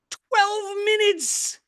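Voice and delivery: high, drawn-out disbelief; slow, high-pitched incredulous cry; incredulous emphasis. Real, not acted. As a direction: stretch it out in exasperation. Exasperated at humans' short time here.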